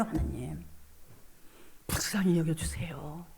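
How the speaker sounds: whispered plea, barely voiced. Whispering a plea to God for mercy. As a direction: breathe the words out to God.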